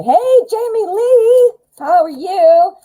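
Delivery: high, slow sing-song hello; excited greeting. Real, not acted. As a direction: greet a friend with delight. Greeting a viewer joining live.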